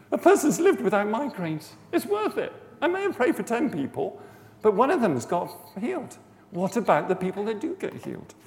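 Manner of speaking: high, loud, impassioned insistence; real emotional fervour. Real, not acted. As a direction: argue it with heartfelt urgency. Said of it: "I'm getting emotional about this".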